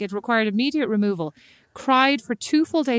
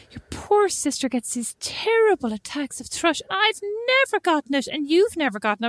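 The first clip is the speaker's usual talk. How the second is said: mum's voice; 6 semitones higher than usual. Retold or imitated. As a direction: voice mum fussing over the poor sister. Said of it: "she said".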